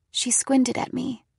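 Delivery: ordinary delivery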